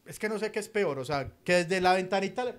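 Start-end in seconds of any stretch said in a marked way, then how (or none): none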